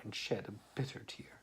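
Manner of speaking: soft sad whisper. A narrator's reading. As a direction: breathe it out sorrowfully. Sad narration line whispered.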